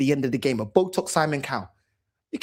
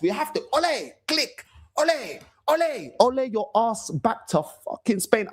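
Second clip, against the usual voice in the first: higher, hammed-up Spanish manager impression; sing-song ole taunt at the manager; high mock Arteta; 5 semitones higher than usual. Fan mocking Arteta with ole chants, higher pitched.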